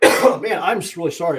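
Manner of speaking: apologetic, voice catching; fighting back tears; choked up. Real, not acted. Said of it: "I'm really choking up here".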